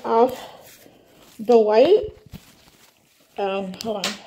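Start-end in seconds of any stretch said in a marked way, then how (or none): none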